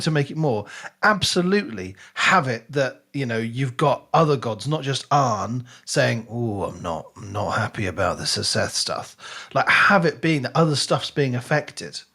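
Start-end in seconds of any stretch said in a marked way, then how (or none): none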